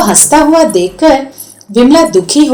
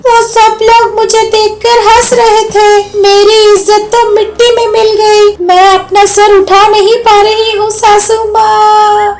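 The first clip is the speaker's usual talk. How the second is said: tearful complaint; 10 semitones higher than usual. Acted. Vimla laments to mother-in-law, high tearful whine. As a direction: lament your lost honour.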